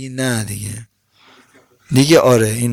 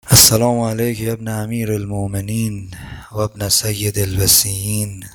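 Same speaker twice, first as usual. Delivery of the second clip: low, measured Arabic recitation; devotional salutation; 6 semitones lower than usual. Real, not acted. Preacher closing the sermon by saluting Imam Hussein, low reverent recitation.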